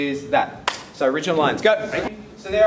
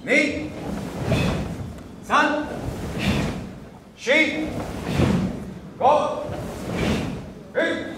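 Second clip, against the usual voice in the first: high-pitched, sharp count calls; drill count; 9 semitones higher, much slower than usual. Real, not acted. Karate teacher counting students through a drill, high, sharp calls. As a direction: call each count out high and short.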